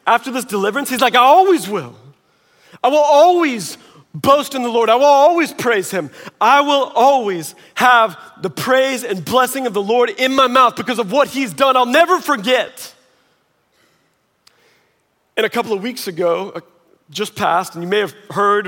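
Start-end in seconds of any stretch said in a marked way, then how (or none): 1.1-12.9 loud, high triumphant vow; voicing David's bold promise; declaring loudly. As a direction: proclaim it with full conviction.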